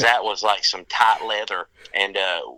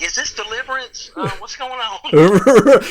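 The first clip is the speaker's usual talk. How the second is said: higher, slow hammed-up hillbilly voice; slow mock yokel, pitched higher and louder; mock backwoods voice; 10 semitones higher, 8 dB louder than usual. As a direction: play a lightly mocking backwoods yokel. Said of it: "then you hear that voice coming out".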